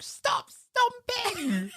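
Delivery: high, slow mock mum shout; nagging mum. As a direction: play the cross mum, drawn out. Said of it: "She's like".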